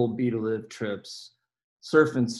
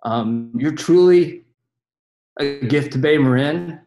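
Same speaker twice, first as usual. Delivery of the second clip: swelling heartfelt tribute; 3 semitones higher, 5 dB louder than usual. Real, not acted. To Brian at his farewell, voice swelling louder with feeling. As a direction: let the gratitude build and spill over.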